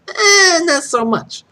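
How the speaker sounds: high, loud mock "Eh" of a sniffy fan; dismissive fan. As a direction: brush it off like a snob.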